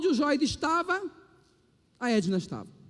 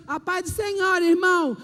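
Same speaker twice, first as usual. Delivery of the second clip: mock effeminate greeting; 5 semitones higher, 5 dB louder than usual. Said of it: "imagine se eu chegasse aqui".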